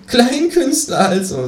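high, slow, mocking kangaroo; drawn-out high kangaroo sneer. Kangaroo mocking the cabaret artist, slow and high. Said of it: "sagt es und lacht kurz auf".

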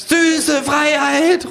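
very slow, high savouring cry; kangaroo exulting. Acted. Kangaroo drowning the phone in beer, high slow exulting. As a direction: savour every syllable. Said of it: "ruft das Känguru".

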